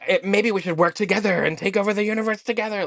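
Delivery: hammed-up villain plotting to rule the universe; two Frieza actors end their fake fight, hammed-up villain voice; mock Frieza villain. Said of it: "they were like".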